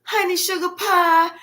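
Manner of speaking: lightly voiced saccharine politeness; mocking sweet talk. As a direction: put on a syrupy sweet-talker. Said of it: "You wasn't using all the".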